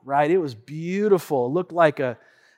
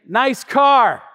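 higher-pitched passing compliment; retold valet remark; 5 semitones higher, 4 dB louder than usual. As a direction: toss off a "nice car" as the valet. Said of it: "I don't think he said it like that".

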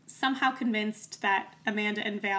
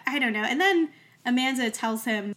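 normal talking voice

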